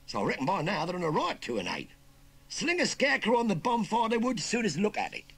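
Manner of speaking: loud, high Cockney threat; cocky warning. Acted. Youngster warning uncle against escaping, cocky. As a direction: warn him off, brash and loud. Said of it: "warned the cocky youngster".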